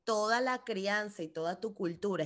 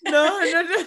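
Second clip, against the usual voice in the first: no no squeezed out through loud laughter; real laughing denial; laughing protest; 7 semitones higher, 11 dB louder than usual. Real, not acted. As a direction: laugh while refusing.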